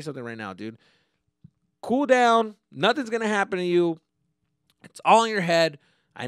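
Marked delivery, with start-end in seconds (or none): 1.8-5.8 higher and louder, pleading with them to calm down; urgent loud reassurance. Real, not acted. Podcaster urging anxious listeners to calm down, loud and insistent. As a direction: shout the comfort right at them.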